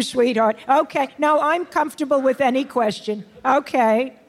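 ordinary delivery

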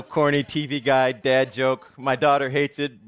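ordinary talk, nothing marked

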